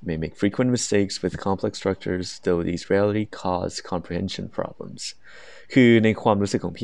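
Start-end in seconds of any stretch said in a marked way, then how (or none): 0.1-5.0 slow, careful rubric reading; very slow, deliberate reading of criteria; reading aloud. Shown as a demonstration. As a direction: read the descriptor out word by word. Reads band descriptor aloud.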